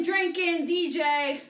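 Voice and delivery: normal talking voice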